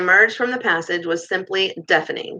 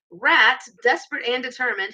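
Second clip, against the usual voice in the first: ordinary delivery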